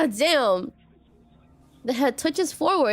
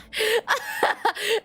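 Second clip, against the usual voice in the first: high, nervous laugh; 9 semitones higher than usual. Real, not acted. Streamer laughs nervously at the creepy deity, high laugh. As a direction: laugh the fear off.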